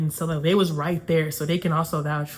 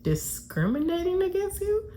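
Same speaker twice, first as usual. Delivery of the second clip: high, rising disbelieving question; incredulous; 11 semitones higher, 4 dB quieter than usual. Retold or imitated. Woman throws his accusation back at him, incredulous. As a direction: echo the accusation in mock disbelief, pitch climbing. Acts out her disbelieving reply.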